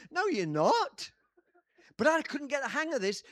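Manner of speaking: loud, slowing, insistent pulpit voice; full-force preaching. Real, not acted. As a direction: drive it home to the whole church. Preaching peak continues.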